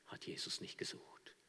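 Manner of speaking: breathy hushed whisper. Real, not acted. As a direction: lean in and whisper it. Preacher drops to hushed whisper. (geflüstert)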